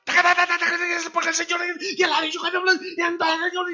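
high, loud, rapid-fire mock preaching; machine-gun preacher. Pastor mocking rushed preachers, rapid-fire shouting parody. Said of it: "parece metralladora".

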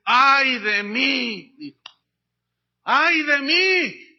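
slow, loud wail of dismay; anguished lament. Acted. Preacher voicing Isaiah before God's glory, slow loud wail.

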